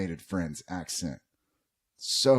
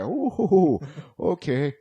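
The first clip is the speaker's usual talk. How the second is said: taken aback; 9 semitones higher, 7 dB louder, much slower than usual. Retold or imitated. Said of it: "It was like".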